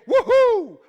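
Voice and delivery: high, loud joyful woohoo; gleeful cheer. Retold or imitated. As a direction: whoop with delight. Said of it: "could say".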